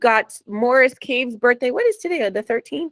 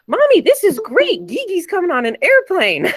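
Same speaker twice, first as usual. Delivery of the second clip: put-on high, bright-eyed child voice, much higher and louder; excited little nephew; 9 semitones higher, 3 dB louder than usual. Aunt retelling her nephew's airplane joy, high excited child voice. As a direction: imitate a thrilled little boy telling his mom the news. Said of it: "and he said".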